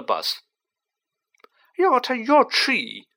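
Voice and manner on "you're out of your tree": loud, high-pitched yelp; high, loud character yelp. A narrator's reading. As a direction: yelp it in startled protest. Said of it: "yelped Stan".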